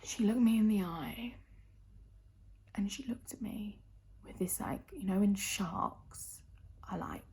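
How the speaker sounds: hushed, menacing stage whisper; up close and personal. Retold or imitated. Telling how Nan stared like a shark, intimate whisper. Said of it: "I'm gonna get up close and personal".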